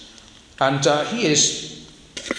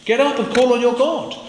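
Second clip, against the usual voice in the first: high, loud urgent order; urgent command; 9 semitones higher, 5 dB louder than usual. Acted. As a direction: rouse him to call on his God. Captain's urgent command voiced.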